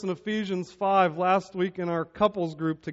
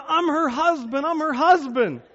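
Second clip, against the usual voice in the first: high, loud pleading repeat; desperate protest; 9 semitones higher, 5 dB louder than usual. Retold or imitated. Husband tells security guard who he is, panicked shouting. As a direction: insist over and over to the guard. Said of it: "And I'm going".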